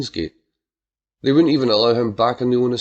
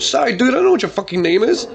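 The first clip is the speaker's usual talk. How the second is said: flustered apology; 10 semitones higher, 4 dB louder than usual. Acted. Sheepish apology after wrong name.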